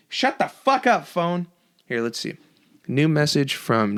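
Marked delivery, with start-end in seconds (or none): 0.1-1.5 high, irritated bark at the phone; yelling at an interrupting phone, annoyed; real annoyed outburst. Real, not acted. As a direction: snap at the ringing phone. Phone interrupts his rant.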